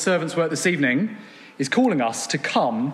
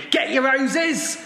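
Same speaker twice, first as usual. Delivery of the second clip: market trader call; 10 semitones higher than usual. Retold or imitated. Preacher imitating a Hemel High Street trader, shouting. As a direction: cry your wares across the high street. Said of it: "you know the sound".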